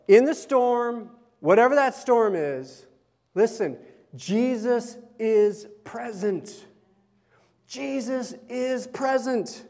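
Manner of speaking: slow, solemn declaration; proclaiming. Acted. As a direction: lay each word down slowly.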